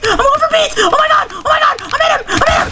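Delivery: screaming high in panic; frantic high yelling. Real, not acted. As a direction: yell it high, all-out frenzy. Frantic moment in a fight.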